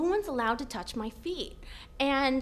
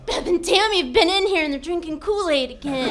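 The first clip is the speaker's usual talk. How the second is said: higher, louder bewildered blurt; dazed confusion; 4 semitones higher, 9 dB louder than usual. Retold or imitated. Bleeding woman blaming her roommates, dazed loud blurt. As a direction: blurt it out, confused from blood loss. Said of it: "And I'm like".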